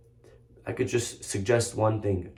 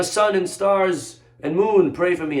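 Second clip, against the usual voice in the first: high, slow, raised call to the heavens; retold plea; 7 semitones higher, 4 dB louder, slower than usual. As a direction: draw out an imploring prayer. Retold prayer to sun and stars.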